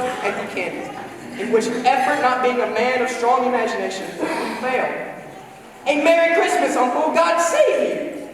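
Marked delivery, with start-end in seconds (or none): 5.9-8.3 cheerful greeting. A narrator's reading. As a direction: call out merrily to your uncle. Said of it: "said a cheerful voice".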